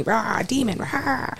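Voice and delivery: mock demon growl, half-hearted; lightly growled demon voice. Shown as a demonstration. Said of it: "like me".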